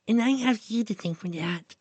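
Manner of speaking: put-on high, girlish character voice; high-voiced Duck thanking a friend; Duck's grateful voice. A narrator's reading. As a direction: pitch it well up as a thankful young girl. Duck's grateful line voiced in story.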